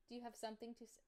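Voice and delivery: high, soft teasing to a pet; talking to the dog. Real, not acted. Repeats question to dog.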